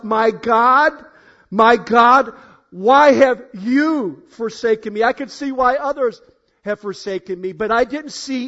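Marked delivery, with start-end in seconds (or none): 0.0-5.0 loud, anguished cry of abandonment. Acted. Preacher crying out as Jesus on the cross. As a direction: cry it out in agony to God.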